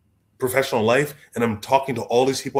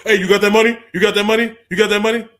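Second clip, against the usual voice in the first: louder, higher pushy money-grubbing voice; greedy relative; 9 semitones higher, 9 dB louder than usual. Retold or imitated. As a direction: hound him for cash, mock-eager.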